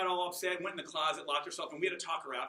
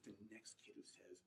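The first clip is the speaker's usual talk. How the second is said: near tears; 9 semitones lower, 27 dB quieter than usual. Retold or imitated. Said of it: "she's almost about to cry".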